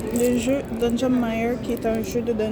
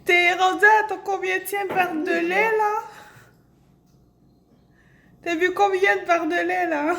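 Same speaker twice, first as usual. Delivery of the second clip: teasing; 6 semitones higher than usual. Real, not acted. Teasing sister gulping milk.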